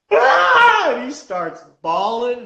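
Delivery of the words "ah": imitating a kid's shriek, much higher and louder; mock child's cry of pain; kid's wail. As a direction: let out the kid's sudden pained yell. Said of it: "and he goes".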